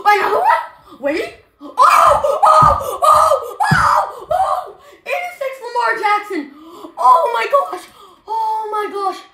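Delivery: very high, loud, laughing shrieks; ecstatic shouting. Real, not acted. Kid screaming at pulling Lamar Jackson. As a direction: lose it completely over the card.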